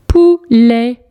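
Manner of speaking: "Poulet" split into pou, let with a gap; syllable by syllable. Shown as a demonstration. Teacher correcting a worksheet, syllable by syllable. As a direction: sound out "Poulet" in two separate beats.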